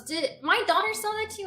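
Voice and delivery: high, loud, quick mock questioning; imitated indignant lady. Yard sale lady asking about her daughter's sale, imitated indignation. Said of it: "She goes".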